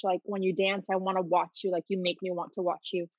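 ordinary talk

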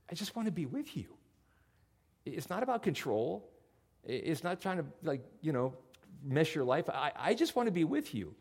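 soft, gentle wife's plea; tender plea. Retold or imitated. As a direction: say it softly, longing to be close. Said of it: "what I really heard her saying".

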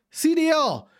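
high-pitched, exasperated call; pissed-off call-out. Real, not acted. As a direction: call them out by name, fed up. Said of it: "I'm pissed right now".

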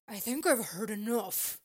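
loud, firm interruption; confronting officer. A narrator's reading. Officer stepping out to stop teacher, loud firm voice. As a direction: cut in with authority, loudly.

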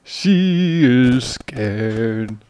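slow vampire drawl. Pretend. Continuing vampire voice.

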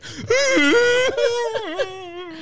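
very high fake wail; pained, hammed-up bawling; mock crying. Comic forcing his daily cry, mock wail. Pretends to force twenty-minute cry.

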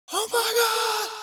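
high shrieking mock excitement; excited shout. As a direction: shriek it like a thrilled kid. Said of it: "And he just".

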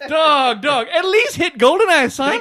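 loud, high-pitched pleading with a buddy; incredulous urging. Real, not acted. Pleading with friend to watch Bond.

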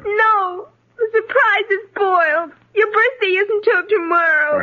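put-on inconsolable tearful child; crying. Girl refusing father's comfort over spoiled surprise, crying. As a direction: wail it between sobs. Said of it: "please stop crying".

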